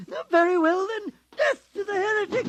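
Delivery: high, shrill shouted curse; crazed shout. Acted. Priest condemning heretic as he shoots, high crazed shout. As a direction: scream it as you fire.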